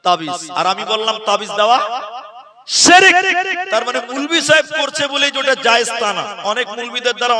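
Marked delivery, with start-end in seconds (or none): 2.8-3.2 high, loud single word; emphatic verdict. Acted. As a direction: slam the word down. Punchline verdict.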